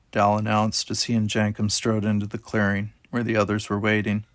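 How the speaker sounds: ordinary delivery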